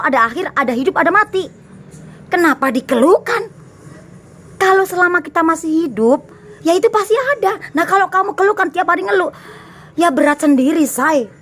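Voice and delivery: high, heated preaching that keeps climbing; lecturing a listener who keeps complaining; fired-up preaching. Real, not acted. Preachy rant, voice raised high.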